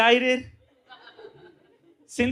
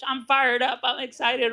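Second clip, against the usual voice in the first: mock recruiter hype, pitched higher; lightly parodied pep; excited; 4 semitones higher than usual. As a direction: imitate a fired-up salesman. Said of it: "You know the, the Primerica language".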